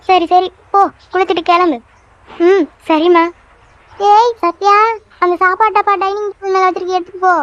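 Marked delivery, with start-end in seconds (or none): 3.9-7.4 higher-pitched mother calling out; calling after someone. Acted. Mother reminding Satya about the lunch box, calling out. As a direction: call out a hurried reminder.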